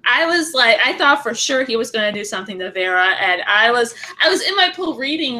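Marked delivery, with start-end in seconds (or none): none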